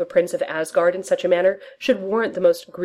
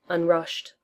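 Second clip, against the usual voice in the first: plain reading voice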